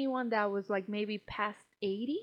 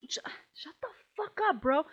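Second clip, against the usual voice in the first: fed-up snap; 7 semitones higher than usual. Retold or imitated. Snapping at the rude guy.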